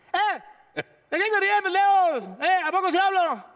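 high, loud gruff boxer voice; Julio Chávez imitation. Julio scolding the laughing crew.

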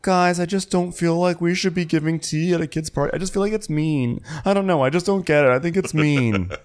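whiny-high mock objection; Kyle Richards. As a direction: imitate a squeamish castmate objecting. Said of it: "she would have been like".

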